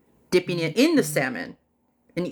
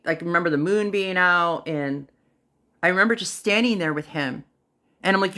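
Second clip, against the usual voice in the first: ordinary talk, nothing marked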